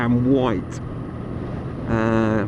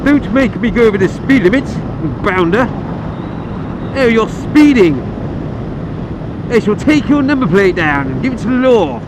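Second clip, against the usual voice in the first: mock posh scold; 5 semitones higher than usual. Posh scolding of speeding cars.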